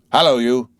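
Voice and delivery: high, loud challenge; guard's hail. A narrator's reading. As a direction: call out sharply to the rider. Guard hails the rider.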